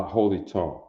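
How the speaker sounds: ordinary delivery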